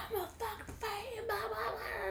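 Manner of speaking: mock drunk screaming. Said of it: "like, screaming".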